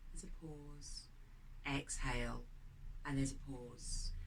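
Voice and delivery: quiet guided breathing meditation voice. Acted. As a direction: lead the breath calmly and gently.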